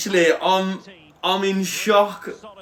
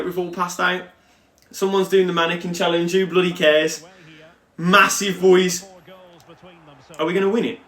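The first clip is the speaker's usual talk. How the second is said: ordinary delivery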